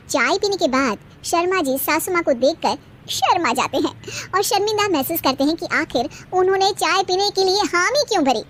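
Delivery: usual speaking voice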